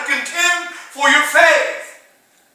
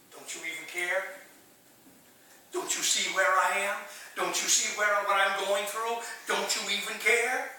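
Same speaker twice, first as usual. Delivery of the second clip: hushed plea; 3 semitones lower, 11 dB quieter than usual. Acted. Voicing believers' hushed complaint.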